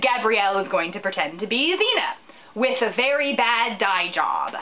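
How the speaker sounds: usual speaking voice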